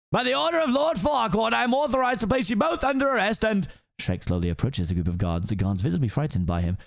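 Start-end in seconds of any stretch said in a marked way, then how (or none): 0.1-3.7 high officious proclamation; pompous guard captain. Acted. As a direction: announce the arrest grandly. Captain reads arrest order.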